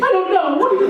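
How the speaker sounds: high-pitched, flustered protest; mock flustered. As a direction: squeal it like a cornered interviewee. Flustered interviewee pressed for answer.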